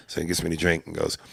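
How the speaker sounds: ordinary delivery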